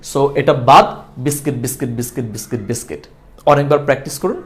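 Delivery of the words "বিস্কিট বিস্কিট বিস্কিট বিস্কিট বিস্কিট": "বিস্কিট" repeated softly as bis-kit, lower each time; correct pronunciation drill. Shown as a demonstration. Teacher drills the corrected word for practice, correct pronunciation. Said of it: "সঠিকটা বিস্কিট".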